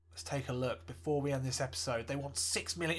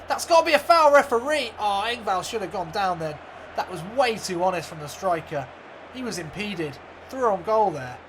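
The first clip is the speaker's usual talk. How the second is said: live commentary; 4 dB louder than usual. Acted. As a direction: call the match like a TV commentator. Commentator calling the match.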